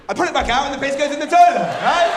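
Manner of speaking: loud, high and fast; proud shout; triumphant. Real, not acted. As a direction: sell it like a genius trick. Triumphant reveal of technique.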